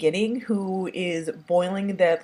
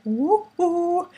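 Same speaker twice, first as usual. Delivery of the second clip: much higher repeated woo woo; mock woo cries; 11 semitones higher than usual. Vlogger standing by his reaction, high playful woo. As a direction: hoot it again, owning the joke. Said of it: "yes, I said".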